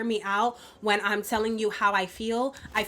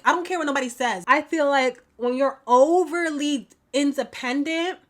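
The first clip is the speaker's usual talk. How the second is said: ordinary delivery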